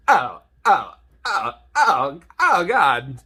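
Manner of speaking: hammed-up moaning oh's; playful orgasm moans; mock orgasm. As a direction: fake ecstasy for a laugh. Mock orgasm over popcorn.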